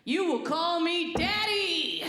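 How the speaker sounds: high, slow, drawn-out mock order; mock commanding. Worker correcting the new cook, mock commanding. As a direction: issue a hammed-up command, stretching it out.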